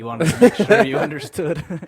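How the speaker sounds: high, loud, cracking up mid-sentence; laughing. Real, not acted. Host teasing the co-host about his wording, loud and laughing. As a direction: tease through real laughter.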